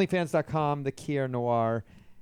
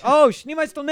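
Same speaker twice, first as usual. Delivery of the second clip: high, loud mock exclamation; mock sudden recognition; 12 dB louder than usual. Russian speaker finally understands the phrase, loud mock recognition. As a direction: burst out as if it finally makes sense. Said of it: "and they're like".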